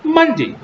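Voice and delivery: "Monday" with high, loud first syllable, dropping sharply; exact pronunciation. Shown as a demonstration. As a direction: punch MON high, let "day" fall short. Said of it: "word in exact pronunciation".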